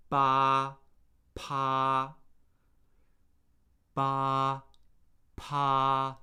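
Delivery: "Ba" and "pa" held on one level pitch; first tone. Shown as a demonstration. Teacher leading a tone warm-up, level first tone on "Ba, pa". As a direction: keep "Ba, pa" long and level. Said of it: "That's the first tone".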